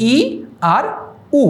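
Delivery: ই then উ said as isolated, clearly shaped vowels; separate, careful vowel sounds ই and উ; vowel demo. Shown as a demonstration. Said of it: "নিজে একটু উচ্চারণ করে দেখো".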